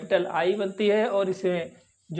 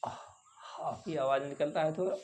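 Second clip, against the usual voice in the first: whisper; 2 semitones lower, 9 dB quieter than usual. Real, not acted. Teacher repeats softly.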